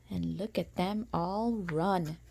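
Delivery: lilting storybook read-aloud; sing-song rhyming narration; reading to children. A narrator's reading. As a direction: read it like a bedtime picture book. Reading picture book aloud.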